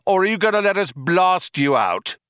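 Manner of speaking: high, projected challenge; cop shouting. A narrator's reading. Cop demands the fugitives surrender, shouting. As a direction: call it out as a demand. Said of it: "shouted one of the cops again".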